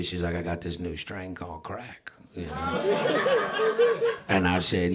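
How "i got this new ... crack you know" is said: hushed, breathy offer of a forbidden thing; secretive whisper. Retold or imitated. Girlfriend whispering a drug offer to him, hushed. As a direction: whisper it like a dealer in your ear.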